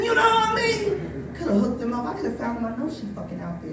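ordinary talk, nothing marked

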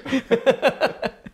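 a sudden peal of laughter; high burst of laughter. Real, not acted. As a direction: laugh out loud, high and bright. Laughing at his own joke.

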